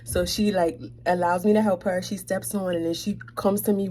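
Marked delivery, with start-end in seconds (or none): none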